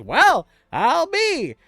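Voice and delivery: high, loud, slow goblin praise. Acted. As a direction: drawl out the praise like a proud goblin. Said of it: "he says".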